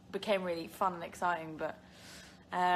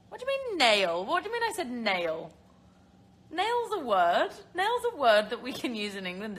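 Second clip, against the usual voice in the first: mock indignation; 3 semitones higher, 6 dB louder than usual. Real, not acted. Host answering a jibe about her lost accent, mock indignant. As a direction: protest playfully, pitch leaping up on the challenged word.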